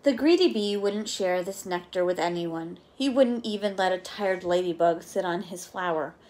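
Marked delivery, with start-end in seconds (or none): none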